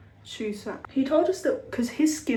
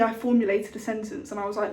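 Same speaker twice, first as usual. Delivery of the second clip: normal talking voice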